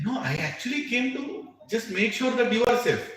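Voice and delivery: high, startled defense; high surprised younger brother; surprised protest. Retold or imitated. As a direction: protest your innocence, taken aback. Arjuna pleads innocence.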